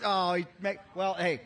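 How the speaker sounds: usual speaking voice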